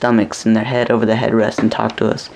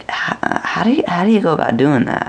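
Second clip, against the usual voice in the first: much higher, baffled disbelief; incredulous question; 6 semitones higher than usual. Retold or imitated. Dreamer asking the hopping couple, baffled. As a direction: ask it like you can't believe your eyes. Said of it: "And I'm like".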